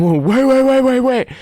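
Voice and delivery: mock alarmed stop. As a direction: halt everyone, panicking.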